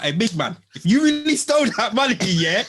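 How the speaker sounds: high, loud, hurried mock protest; animated retold remonstration; indignant neighbour impression. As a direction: imitate a neighbour stepping in, voice jumping up. Acting out neighbour's indignant intervention.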